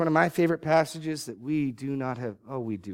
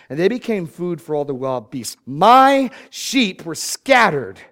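forceful preaching read of a divine rebuke, building louder; scripture reading; 4 dB louder than usual. Acted. Preacher reading God's rebuke of false shepherds, forceful. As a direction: thunder the prophet's charge, slowing at the end.

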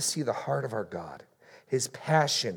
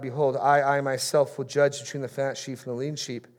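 1 scripture reading; 4 semitones higher, 4 dB louder than usual. Acted.

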